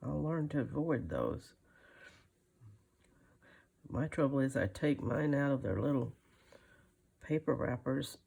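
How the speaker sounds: ordinary talk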